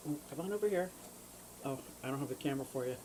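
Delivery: soft murmur to a fish; soft coaxing. Real, not acted. Calling her fish to the tank glass, soft coaxing.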